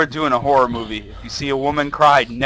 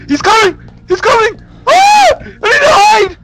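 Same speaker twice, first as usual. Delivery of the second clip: very high, loud, urgent shrieks; terrified screaming; 11 dB louder than usual. Real, not acted. Player warning that he's coming, screaming in fright. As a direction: scream for a place to hide. Threat coming, needs to hide.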